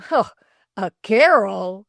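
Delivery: scoffing. Acted. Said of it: "scoffed the lay reader".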